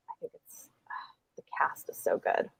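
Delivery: breathy whispered aside; hushed, almost voiceless. Real, not acted. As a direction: drop to a whisper.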